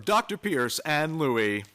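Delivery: ordinary delivery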